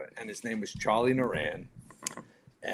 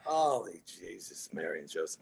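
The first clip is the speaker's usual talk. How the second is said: hushed dismay; 7 semitones lower, 9 dB quieter than usual. Retold or imitated. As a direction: sink down low and soft, appalled. Retold dismay at the magazine.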